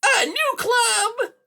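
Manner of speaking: very high, loud, slow cat shriek; piercing villain exclamation; outraged cry. A narrator's reading. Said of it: "Silversides cried".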